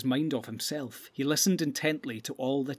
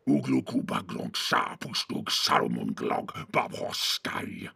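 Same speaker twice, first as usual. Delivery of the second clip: whisper yelling; 13 semitones lower, slower than usual. A narrator's reading. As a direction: hiss the curse, harsh and slow. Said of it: "angry speech in his own tongue".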